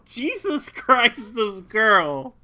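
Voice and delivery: slow, loud exasperation; exasperated, drawn out. Acted. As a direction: drag it out in disbelief. Repeated louder and slower.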